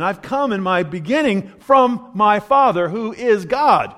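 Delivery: slowed, weighted delivery on Father and God; slow emphatic statement. Acted. Preacher stressing Jesus's words to the congregation, slow and emphatic. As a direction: lean hard into each word, slowly. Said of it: "giving this strong statement".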